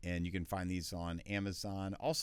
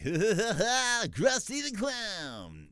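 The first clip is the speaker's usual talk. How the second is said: much higher, very slow cartoon clown rasp; Krusty the Clown; 13 semitones higher, 7 dB louder, much slower than usual. Voice actor doing a cartoon clown character, hammed up. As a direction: ham up the clown's bray. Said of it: "a character, like".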